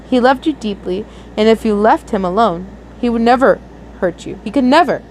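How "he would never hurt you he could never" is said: ordinary delivery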